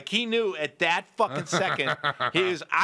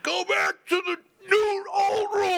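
mock wail; 12 semitones higher than usual. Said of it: "And I'm like".

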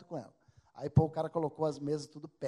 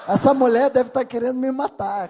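laughing; 6 semitones higher, 14 dB louder than usual. Real, not acted. Laughing at his own disaster.

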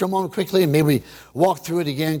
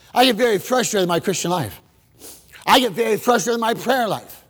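ordinary talk

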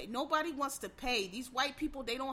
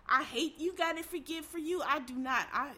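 ordinary talk

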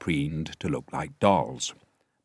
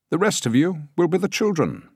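much higher, louder director voice; declaring director; 12 semitones higher, 6 dB louder than usual. A narrator's reading. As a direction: declare it grandly to the crowd. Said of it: "he declared".

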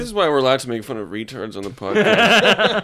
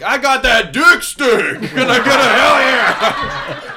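loud macho mock voice; 9 semitones higher, 6 dB louder, slower than usual. Comic mocks men bragging.

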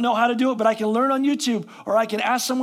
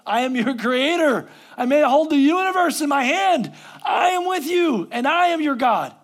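full-force preaching; 4 semitones higher, 4 dB louder than usual. Acted. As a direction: proclaim God's promise to the congregation. Preaching verse with rising force.

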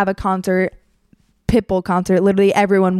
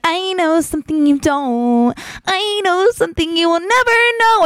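weird taunting; 6 semitones higher than usual. Retold or imitated. Some guy acting really weird, singsong taunting. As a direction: imitate a weirdo's teasing chant, rising higher.

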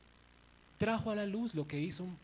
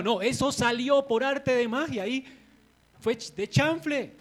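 loud, higher, slow fake innocence; Aaron's mock innocence; 6 semitones higher, 10 dB louder than usual. Aaron claiming the calf just appeared, slow and mock innocent. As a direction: play it off as pure magic. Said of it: "él dijo".

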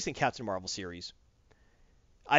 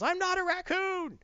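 much higher, slow offended yell; indignant yell; 14 semitones higher, slower than usual. Retold or imitated. Rocket yells he is not a raccoon, high and offended. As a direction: shout the insult back, offended.

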